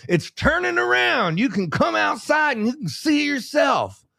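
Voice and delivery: high, stammering mock excitement; overexcited. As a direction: gush like a kid spotting a ship. Re-enacting his thrilled ship watching.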